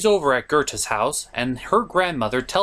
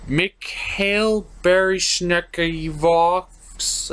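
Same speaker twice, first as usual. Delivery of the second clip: slow struggling read; much slower than usual. Real, not acted. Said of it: "what is this word?".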